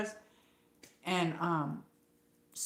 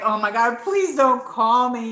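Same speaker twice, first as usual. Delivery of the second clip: louder, pleading mock panic; mock dread; 4 semitones higher, 7 dB louder than usual. To her viewers, retelling fear of being called, mock-pleading. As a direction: beg half-jokingly, slowed down.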